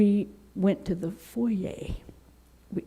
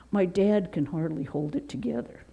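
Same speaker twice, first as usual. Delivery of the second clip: normal talking voice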